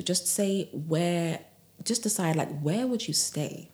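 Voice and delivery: ordinary delivery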